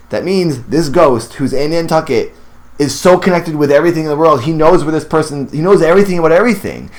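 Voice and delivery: rushing on, mind blown; carried away and racing ahead; excited. Real, not acted. Gushing over the ghost's power.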